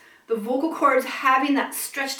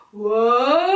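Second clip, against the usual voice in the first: vocal siren; 5 dB louder than usual. Shown as a demonstration. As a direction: slide up like a siren. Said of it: "You're gonna go".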